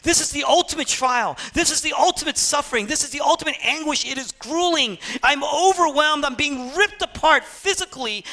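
high-pitched, urgent outpouring; preacher voicing overwhelming torment; acted anguish. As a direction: pile up the agony in an impassioned rush. Voices Jesus's anguish on the cross.

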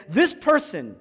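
emphatic courtroom accusation; accusing. Retold or imitated. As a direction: make the case against them forcefully. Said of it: "The accuser says".

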